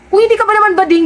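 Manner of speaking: usual speaking voice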